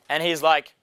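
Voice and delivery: normal talking voice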